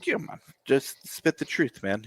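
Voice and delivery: ordinary delivery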